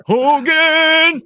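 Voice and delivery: high, long drawn-out German-accented holler; loud mock-German cry, stretched right out; comic German accent. Shown as a demonstration. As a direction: call it out like a sitcom German commandant. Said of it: "my German accent sounds like".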